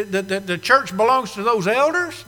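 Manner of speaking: usual speaking voice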